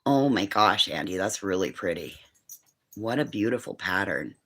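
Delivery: hushed and adoring. Real, not acted. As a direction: coo over the drawing gently. Praising a child's drawing.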